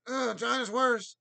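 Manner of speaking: high, loud, slow wailing Alex Jones impression; mock Alex Jones cry. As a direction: wail it like Alex Jones lamenting. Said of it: "when Alex Jones goes".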